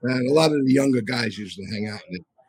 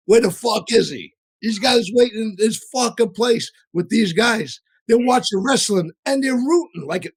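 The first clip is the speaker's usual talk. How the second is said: higher, slower, heated retelling of an angry outburst; half-acted fury, pitched up and dragged out; Joe Watts fuming; 9 semitones higher, 4 dB louder than usual. Captain fuming that the boss keeps everyone waiting, heated. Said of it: "He goes".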